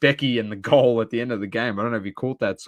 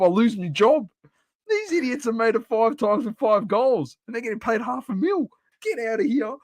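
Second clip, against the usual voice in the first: high-pitched retold rant at the players; a worked-up fan railing at overpaid players, high; ranting in anger; 10 semitones higher than usual. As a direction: vent it like you've lost it at the TV. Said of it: "I was just losing it".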